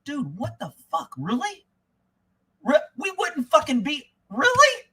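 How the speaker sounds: high, incredulous pretend retort, climbing to a very high really; a hint of imagined scorn at the father; mock disbelief at father. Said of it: "I'd be looking at him like".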